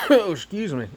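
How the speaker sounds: coughing, bug caught in throat; clearing throat after a bug; just swallowed a bug. Real, not acted. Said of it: "I think I ate a bug".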